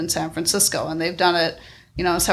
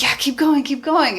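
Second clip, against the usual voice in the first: opgewonden aansporing; 8 semitones higher, 3 dB louder than usual. Acted. Voicing the urge of the rush.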